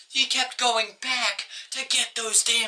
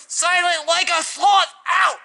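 louder, higher hammed-up yell to close the video; shouted sign-off; 4 semitones higher, 5 dB louder than usual.

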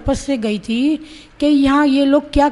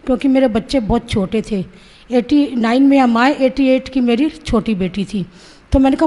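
ordinary delivery

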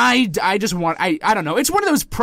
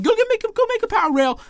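mock viewers yelling; 12 semitones higher, much faster than usual. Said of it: "screaming at your screen saying".

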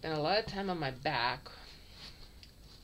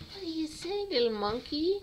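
much higher, louder cooing; 11 semitones higher, 5 dB louder than usual. Real, not acted. Cooing to a kitten.

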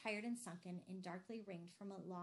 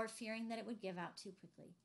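usual speaking voice

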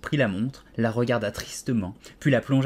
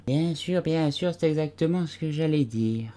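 higher, sleepy mumble; mumbled with eyes shut; 7 semitones higher than usual. A narrator's reading. The Dormouse mumbles sleepily, still half asleep. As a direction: drowsily agree without waking. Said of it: "marmotta sans ouvrir les yeux".

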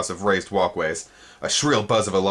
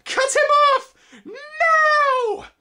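very high, loud commanding screech; shrill insect command; 6 dB louder, much slower than usual. A narrator's reading. Spindlebug ordering the chase, high loud screech.

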